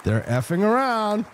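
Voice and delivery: ordinary talk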